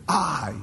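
"I" alone, pitched high and falling; emphatic I. Shown as a demonstration. Preacher shows the Greek emphasis, emphatic high "I". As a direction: set "I" apart, high and weighty. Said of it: "it's emphatic there in the Greek".

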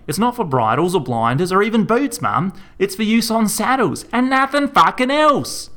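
high, loud shouting, peaking at the end; furious shout. A narrator's reading. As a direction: yell it right in her face. Son shouting at mum.

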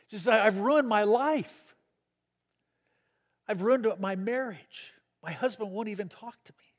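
mildly acted despair, rising into a plea; retold distraught sobbing. Jailed wife confessing to the vicar, retold distraught. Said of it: "sobbing uncontrollably, hysterical".

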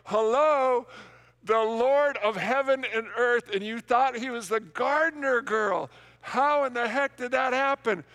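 high, loud, sassy incredulous voice; mock disbelief. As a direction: tease her like she missed the obvious. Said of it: "I'm like".

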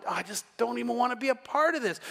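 mock whiny grumble; complaining. Church complainer walking around grumbling, put-on disgust. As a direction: imitate a disgruntled complainer. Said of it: "when people complain".

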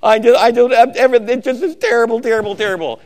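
high, fast mocking whine; mock the timid salesmen whimpering; preacher mocking a scared salesman's whine; whiny scared salesman. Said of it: "They go".

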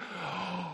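soft gasp of dawning realization; acted guilty intake of breath; mock gasp. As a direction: pretend to catch your breath, caught out. Acts sudden realization of guilt.

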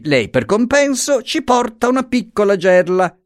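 high-pitched mock-courteous character voice; teasing lawyer. Lawyer ordering the guard to carry the basket, teasingly.

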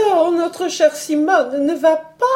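a lady's tearful exclamation; tearful cry. A narrator's reading. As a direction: wail it out, near tears. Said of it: "clamant avec des larmes dans la voix".

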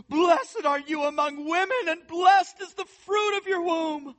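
high, loud proclaiming cry. Acted. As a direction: cry it out with joy. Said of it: "cried out with a loud voice".

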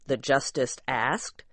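ordinary delivery

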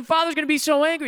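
high-pitched, hammed-up fretting; inconsolable boy. Boy cutting off the neighbor's comfort, mock wailing. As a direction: wail it like a child who won't be soothed. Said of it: "the boy's not getting consoled".

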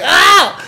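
high, loud fake yelp of pain; mock pained yelp. Host stabbed by his own blade, hammed-up yelp. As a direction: yelp like you've just been stabbed. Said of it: "he's like".

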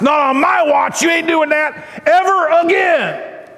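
high, forceful scolding shout; acted outraged wife yelling; indignant wife. Imagined wife's furious outburst at Moses.

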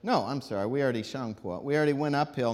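ordinary talk

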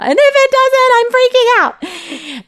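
high, loud mock panic; mock freak-out. Mom acting out her own panic, high and loud. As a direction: squeal it like you're freaking out.

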